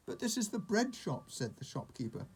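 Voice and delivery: high-pitched, mildly baffled correction; protesting shopkeeper. A narrator's reading. Shopkeeper to confused old sheep, baffled high protest.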